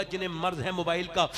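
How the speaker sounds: normal talking voice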